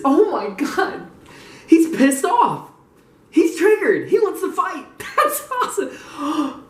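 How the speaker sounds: high, giddy delight breaking into laughter; gleeful disbelief. Real, not acted. Reading that the rival wants to fight, giddy glee. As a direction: crow with delight, laughing by the end.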